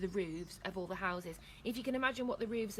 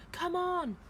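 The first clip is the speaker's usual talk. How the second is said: high, loud call; urgent urging; 10 semitones higher, 6 dB louder than usual. A narrator's reading. Gamage to the princess, loud urging. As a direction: hurry them along.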